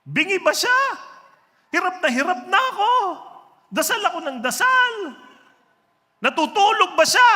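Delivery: mock wailing lament, high and loud; melodramatic Sisa. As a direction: overplay the weeping woman's lament. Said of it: "Sisa, ikaw ba yan?".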